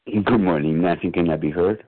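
ordinary talk, nothing marked

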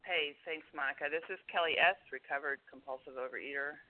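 distant, faint voice; far from mic. Real, not acted. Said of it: "You're very distant sounding".

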